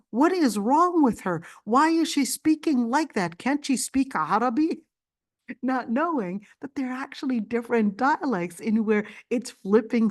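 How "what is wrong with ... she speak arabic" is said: baffled restaurant lady. Retold or imitated. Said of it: "she said".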